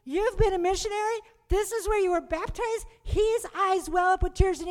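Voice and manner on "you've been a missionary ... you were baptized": higher, fast quizzing voice; a priest amazed at her story; lightly voiced priest, higher and eager; curious priest. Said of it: "he goes".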